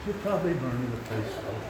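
dainty, overly careful way to say it; mock polite. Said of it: "If you'd say".

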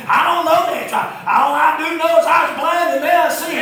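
high, loud exultant preacher's cry; shouted testimony. Retold or imitated. Healed man answering his questioners, shouted testimony. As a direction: belt out the blind man's answer.

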